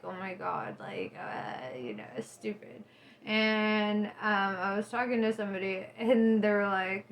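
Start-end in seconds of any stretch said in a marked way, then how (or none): 0.0-2.8 low, soft mock self-pity; mock emotional. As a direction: sink low and quiet, wallowing. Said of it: "I started acting very emotional".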